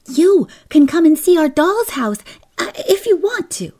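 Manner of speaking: put-on high, loud little-girl voice; eager child inviting. Kezia invites the Kelvies from the gate, eager and high.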